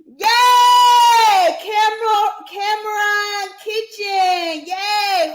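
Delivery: high, loud cheer, drawn out slowly; excited winner shout. Real, not acted. Announcing giveaway winner.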